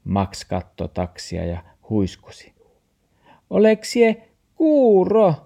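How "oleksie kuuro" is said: high, loud, slow yelling; scolding dog. A narrator's reading. Dog asks Max if he is deaf, loud high scolding. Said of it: "taksi jylkytti".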